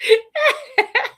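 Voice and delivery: a high, loud burst of real laughter. Real, not acted. Laughing hard at her husband's scam-baiting story.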